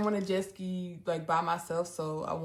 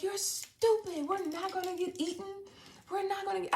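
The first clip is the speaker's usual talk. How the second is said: scolding sister; 11 semitones higher, 5 dB quieter than usual. Retold or imitated. Sister dismissing fears of being eaten, high annoyed scold. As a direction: play the sister talking her down, annoyed. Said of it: "she's like".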